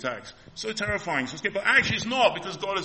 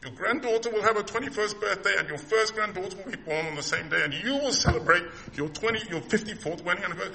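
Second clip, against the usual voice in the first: voicing God; 7 semitones higher than usual. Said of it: "God says to us".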